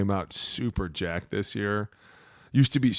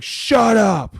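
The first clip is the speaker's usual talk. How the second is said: ref snapping back; 13 semitones higher, 9 dB louder than usual. Retold or imitated.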